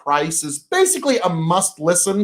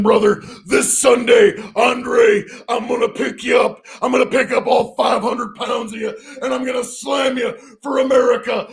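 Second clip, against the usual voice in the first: Hulk Hogan style promo shout; wrestling promo; 3 semitones higher than usual. Pretend. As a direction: growl it like a pro wrestler cutting a promo. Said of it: "Can you do another wrestler impression".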